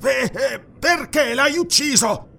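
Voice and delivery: high, agitated, drawn-out question; nervous. A narrator's reading. Nervous guard questioning the stranger over a body, high and agitated. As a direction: ask it in a high-pitched fluster. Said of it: "chiese nervosamente Arus".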